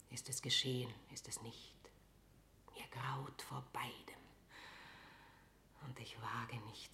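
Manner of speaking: a queen whispering her dread to herself; hushed, fearful inner monologue; whispering alone, afraid to hear the news; anxious whisper. Acted.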